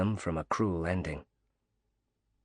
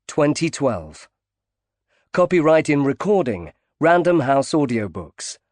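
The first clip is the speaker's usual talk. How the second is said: louder, higher formal credits read; credits announcement; 6 semitones higher, 8 dB louder than usual. Acted. Audiobook closing credits read to listeners, formal announcer. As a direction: announce the copyright notice crisply.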